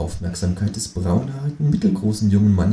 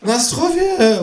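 calling out; 3 dB louder than usual. A narrator's reading. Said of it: "ruft das Känguru".

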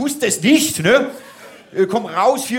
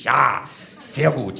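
normal talking voice

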